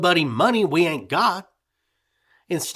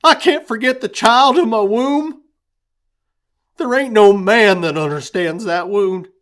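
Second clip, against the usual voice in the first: loud, higher wounded anger; hurt fury; 8 semitones higher, 11 dB louder than usual. A narrator's reading. Grieving mother scolding her husband, loud and wounded. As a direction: make him feel the grief.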